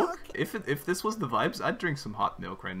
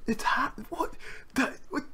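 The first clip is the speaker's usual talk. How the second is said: panicked whisper; slower than usual. Real, not acted. Disconnected from friend's game.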